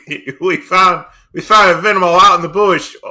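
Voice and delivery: hammed-up Australian, loud and very high; Australian accent. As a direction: stretch it out in broad mock Australian. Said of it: "go with your Australian accent".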